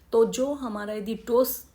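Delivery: normal talking voice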